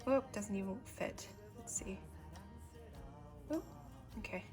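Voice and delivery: ordinary delivery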